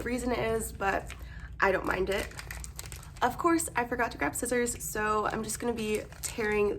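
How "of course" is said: ordinary talk, nothing marked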